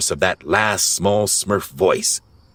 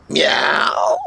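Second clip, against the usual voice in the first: high-pitched imitated cat meow. A narrator's reading. Azrael the cat replies to Gargamel, meowing. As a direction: give a high little cat's meow. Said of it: "was Azrael's reply".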